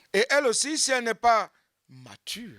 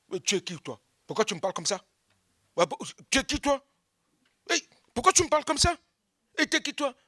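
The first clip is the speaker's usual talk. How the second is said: snapping back, building higher and faster; mock aggressive retort; 7 semitones higher, much faster than usual. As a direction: pick a fight with whoever talks to you. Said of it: "Sans maîtrise".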